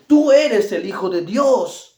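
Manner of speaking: demons shouting, slow and loud; loud, drawn-out cry of the demons. Retold or imitated. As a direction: call it out slowly like terrified spirits. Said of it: "daban voces diciendo".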